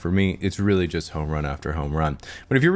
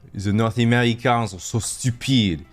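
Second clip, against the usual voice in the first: slow, sneering French put-down; mock French accent; 3 semitones higher than usual. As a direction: lightly play a smug Frenchman. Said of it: "And people would say".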